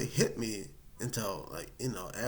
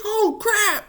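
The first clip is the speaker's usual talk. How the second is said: squeaky high oh crap; high dismayed oh crap; 9 dB louder than usual. Retold or imitated. Telling his girlfriend the news, dismayed. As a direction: yelp of dismay.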